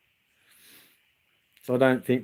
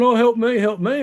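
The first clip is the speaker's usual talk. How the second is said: higher, louder mock whine; whinging; 10 semitones higher, 3 dB louder than usual. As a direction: whinge it like a helpless kid. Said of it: "complaining and whinging".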